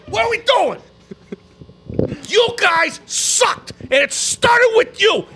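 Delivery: high-pitched, incredulous ranting; pissed off. Real, not acted. Fan scolds a star over thirteen points, pissed off. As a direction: fire scornful questions at the player. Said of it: "I'm fucking pissed".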